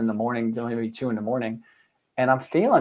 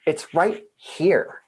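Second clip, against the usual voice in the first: slow emphasis; 4 semitones higher, much slower than usual. Acted. Telling people truth is here.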